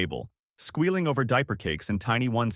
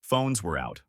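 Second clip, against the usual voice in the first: ordinary delivery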